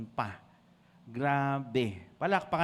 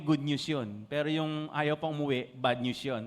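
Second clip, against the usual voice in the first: normal talking voice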